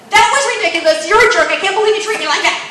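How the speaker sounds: high, loud, rushed scolding; heated, hammed-up tirade; angry accusation. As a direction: lash out as a mock furious wife. Acts the angry reply she avoided.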